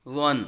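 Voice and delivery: ون begun with a gentle, thick "th", like "dhwan"; soft, full Zwa sound. Shown as a demonstration. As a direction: soften and round the opening of ون. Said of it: "اس کو بھی نرمی سے".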